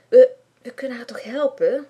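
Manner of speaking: hesitant stammer on "We, we", breathy whisper. A narrator's reading. Shy boy stands up for a classmate, stammering. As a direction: get the words out nervously. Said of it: "hakkelt Bram".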